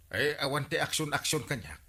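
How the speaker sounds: usual speaking voice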